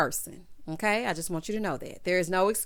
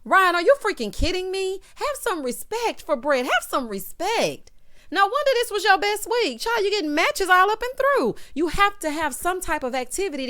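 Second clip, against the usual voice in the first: high, loud telling-off; indignant scolding; 9 semitones higher, 4 dB louder than usual. Real, not acted. Scolding Ryan for disrespecting Brett, high and indignant. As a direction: scold him with the pitch shooting up.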